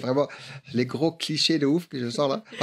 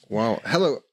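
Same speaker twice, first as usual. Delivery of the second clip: put-on French spoken lower with a loose American accent; 3 semitones lower than usual. Smitten American inner monologue, in a lower American accent. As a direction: drawl it like a lovestruck American. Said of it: "Ok, je fais un américain".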